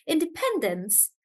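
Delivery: independence with PEN lifted; stress on PEN. Shown as a demonstration. Teacher repeating independence for the class, pen stressed. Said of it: "the stress is on pen".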